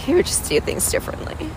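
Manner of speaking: choked, breathy voice breaking high; fighting tears, the words barely voiced; holding back tears. Real, not acted. To the camera about her dad, voice cracking with held-back tears. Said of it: "I'm trying not to cry".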